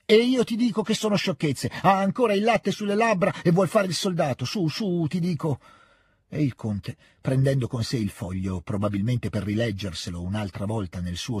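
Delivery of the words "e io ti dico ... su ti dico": much higher-pitched, exasperated dressing-down; angry scolding. A narrator's reading. Count tells Petia he's too young, exasperated scolding. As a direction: scold him high and insistent.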